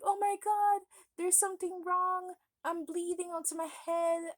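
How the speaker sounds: high, loud panicked wail; a hurt child panicking over a head wound; retold panicked cry. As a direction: replay the terror of bleeding. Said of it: "crying a lot and screaming".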